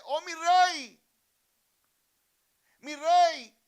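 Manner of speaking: high, very loud acted cry; beggar's shout. Beggar calling after the passing king, shouting. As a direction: call out full force to a passing king. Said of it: "le grita y le dice".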